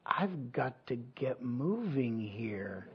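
soft, slow, half-whispered to himself; hushed urgency. Retold or imitated. Murmured slowly, barely above a breath. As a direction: pastor murmurs his dream thought, fleeing attackers, hushed and slow. Dream, fleeing attackers.

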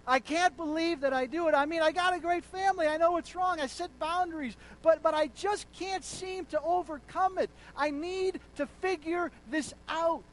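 high, loud anguished plea of a struggling man; pleading confession. Retold or imitated. A man confessing his struggle, pleading loud and high. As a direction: pour out his frustration to the pastor.

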